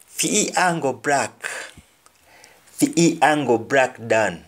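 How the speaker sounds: ordinary talk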